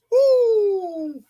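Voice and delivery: high, drawn-out "woo"; real high-pitched cheer; excited whoop. Real, not acted. Cheering the match action.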